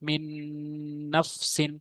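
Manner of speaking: "মিন নফসিন" with the n held in a hum; ghunna recitation. Shown as a demonstration. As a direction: stretch the nasal n linking "মিন" to "নফসিন". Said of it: "গুণনা করে পড়তে হবে".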